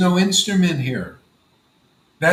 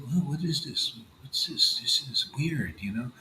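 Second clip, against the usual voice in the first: hushed, half-whispered mock bewilderment; puzzled onlooker; 3 semitones lower, 8 dB quieter than usual. Onlookers who haven't seen such worship, baffled and hushed. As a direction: mutter it softly like a baffled bystander. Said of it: "they think".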